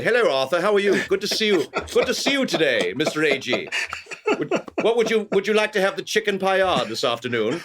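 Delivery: hammed-up British host charm for diners; British accent. Waiter playing a British character for a customer, British accent. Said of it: "I'm gonna be British".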